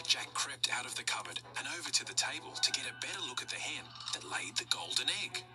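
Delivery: ordinary delivery, nothing marked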